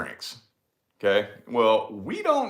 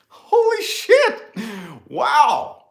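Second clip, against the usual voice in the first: much higher and louder, drawn-out astonishment; amazed; 12 semitones higher, 6 dB louder, much slower than usual. Retold or imitated. At the gas station when the attendant returns the ID, stunned and loud. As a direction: let it burst out slow in disbelief.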